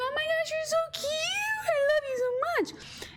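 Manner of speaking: overwhelmed by how cute the kitten is; gushing over kitten. Retold or imitated. Meeting the tabby kitten, very high teary squealing coo.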